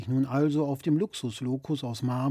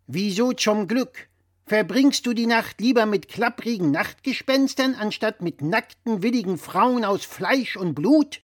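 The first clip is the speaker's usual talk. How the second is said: louder, higher know-it-all dad voice; blustering father; 7 semitones higher, 4 dB louder than usual. Acted. Father lecturing his son over the phone, louder and higher. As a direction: play the pompous father correcting his son.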